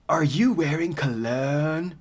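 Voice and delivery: slow, drawn-out mock dialogue; awkward comic character. Reading Mandy's awful dialogue aloud, slow mock-stilted. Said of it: "Mandy says".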